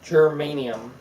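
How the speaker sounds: Germanium said with an extra m sound; mispronounced flower name. Real, not acted. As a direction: say Germanium instead of geranium. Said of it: "There's an M in there, buddy".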